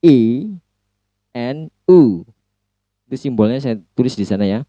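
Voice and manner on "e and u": "E" and "U" said slowly as separate vowel sounds; demo vowel sounds. Shown as a demonstration. Teacher modelling "E" and "U" to class. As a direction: model "E" then "U" for students. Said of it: "by the ending".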